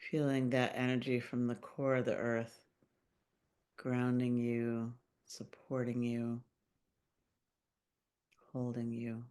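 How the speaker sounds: calm meditation voice with long pauses; unhurried, gently guiding listeners into their bodies; guided meditation. Acted. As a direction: lead a grounding visualization, phrase by phrase, leaving long silences. Leads a grounding meditation.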